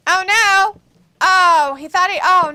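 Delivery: high, loud "oh no"; excited ringside alarm. Real, not acted. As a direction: react to the hit as it lands. Reacting live to a hit.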